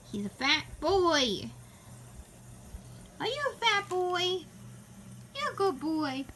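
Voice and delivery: fond cooing pet talk. Real, not acted. Baby talk to her cat.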